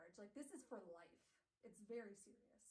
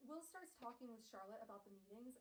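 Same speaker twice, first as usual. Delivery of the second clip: ordinary delivery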